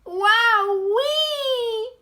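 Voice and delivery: high, loud, stretched exclamation; amazed gasp. A narrator's reading. Smallest fox bowled over by cider, amazed cry. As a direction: whoop it out in amazement. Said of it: "he gasped".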